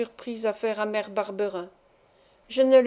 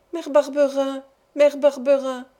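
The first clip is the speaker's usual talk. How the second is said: tearful plea; 4 semitones higher, 5 dB louder than usual. A narrator's reading. Boy sobbing for his mother.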